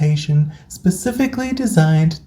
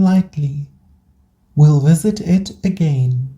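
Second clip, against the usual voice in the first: guided meditation. Acted. Host guiding burnt-out listeners into rest, calm meditation voice. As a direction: guide them gently through each breath, unhurried.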